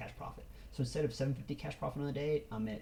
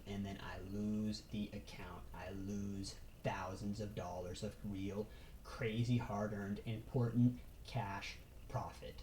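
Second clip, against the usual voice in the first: slow, softer whispered warning; grim whisper; 3 semitones lower, 5 dB quieter, slower than usual. Real, not acted. Trader warning viewers what greed will cost, slow whisper. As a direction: breathe the dread out, slow.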